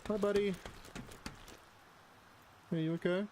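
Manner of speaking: high, sweet voice for a little buddy; pet talk. Real, not acted. Greeting his pet in game.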